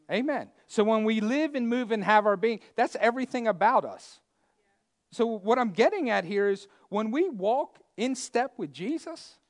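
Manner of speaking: loud, high fiery preaching. Acted. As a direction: preach it with full force. Preaching at full force.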